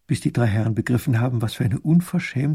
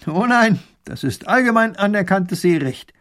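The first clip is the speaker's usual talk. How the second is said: high, loud indignant scolding; 7 semitones higher, 5 dB louder than usual. Acted. Baron ranting at the captain, high and loud. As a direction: rant about piracy. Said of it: "schimpft Raffin".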